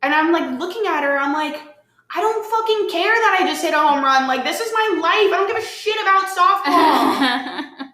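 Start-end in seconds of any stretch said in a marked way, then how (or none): 2.1-7.9 high-pitched, impassioned retort; retold exasperated outburst. Snapping back at a friend who praised her home run, exasperated. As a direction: re-enact fed-up protest, pitch jumping up.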